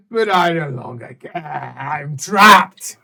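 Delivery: loud desperate shout. Acted. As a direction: cry out in trapped fury. Creature shouts in despair.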